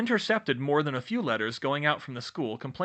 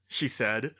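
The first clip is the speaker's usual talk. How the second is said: usual speaking voice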